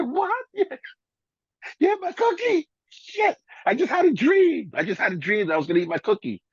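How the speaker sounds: high, squeaky startled disbelief; mock surprise. DJ finds his cookie eaten on waking, in high mock shock. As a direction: yelp it like you just woke up robbed.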